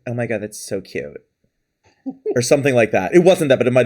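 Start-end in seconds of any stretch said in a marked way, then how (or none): 0.1-1.2 soft, low little voice, imitated; a small, quiet mimicked voice; little put-on voice. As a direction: lightly imitate her cutesy little voice, softly. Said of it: "put on the little voice".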